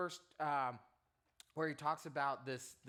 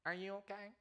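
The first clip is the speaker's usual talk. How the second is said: high, thin little voice from a huge man; big bodybuilder asking gently in a tiny high voice; squeaky voice; 6 semitones higher, 4 dB quieter than usual. Retold or imitated. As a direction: surprise them with a tiny high voice. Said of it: "Literally, his voice".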